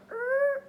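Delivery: high squeaky creak noise; door creak. Retold or imitated. Imitating the door as it was barely touched, high creak.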